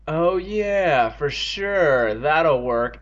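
drawn-out, mocking agreement; sarcastic. Evan scoffs at telling the doctor, sarcastic. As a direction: dismiss the idea with mock enthusiasm. Scornful answer to Andy's suggestion.

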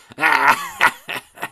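loud open cackle; evil dummy laugh; ugly puppet laugh. Acted. Dummy laughing wickedly across the room, loud.

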